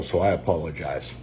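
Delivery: holding back tears while saying sorry; getting emotional. Real, not acted. Apologizing to viewers while getting emotional, softly choked up. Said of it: "I'm gonna get emotional".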